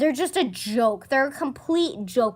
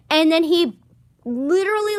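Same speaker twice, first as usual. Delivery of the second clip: ordinary talk, nothing marked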